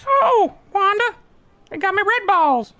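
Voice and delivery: high, slow, strung-out crackhead voice; Tyrone Biggums impression. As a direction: squeak it out like a jittery addict. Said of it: "Tyrone Biggums is my favorite character".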